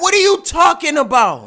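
high, loud baffled outburst; loud incredulous question, pitched right up; exasperated shout. Real, not acted.

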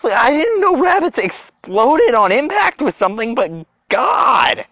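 high, wailing disbelief; shocked disbelief. Real, not acted. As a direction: protest it, still reeling. Still shocked by exploded rabbit.

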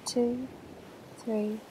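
slow, soft breathing-guide voice; calm meditation voice counting breaths; guided meditation. Acted. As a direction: ease them through each breath, unhurried and hushed.

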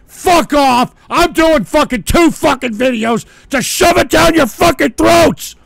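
screaming loud and high; furious shout. Real, not acted. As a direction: yell it in a rage. Raging at the game.

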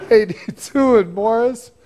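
breaking into giggles mid-forecast; words fighting back laughter; laughing through words. Real, not acted.

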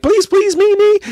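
very high, squeaky pleading; mock eager begging. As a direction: beg like an overeager crowd. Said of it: "they're just kinda like".

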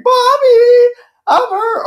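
put-on high, loud, crying child's wail. Mocking the kid calling his mom, in a crying wail. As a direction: wail for mommy like a hurt kid. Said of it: "he's probably like".

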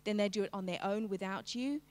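normal talking voice

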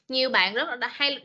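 ordinary delivery

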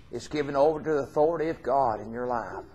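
usual speaking voice

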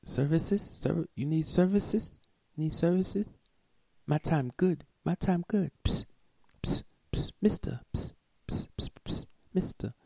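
high, whispered sales pitch; imitating a street solicitor. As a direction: hiss and coax a passerby, lightly mocking. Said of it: "what she say?".

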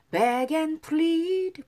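"Beg and plead" stretched very slowly, word by word; drawn out so students can copy each sound; slow pronunciation demo. Shown as a demonstration. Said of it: "발음 다시 따라해볼게요".

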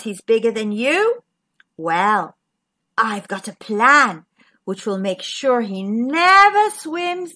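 scheming squid. Acted. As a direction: lay out the plan firmly. Squid lays out plan.

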